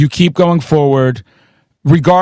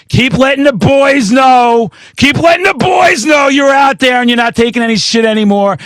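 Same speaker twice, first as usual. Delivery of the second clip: rallying shout; 6 semitones higher than usual. Real, not acted. Cheering on the metal reviewer guest, rallying shout. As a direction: fire up the guest like a coach.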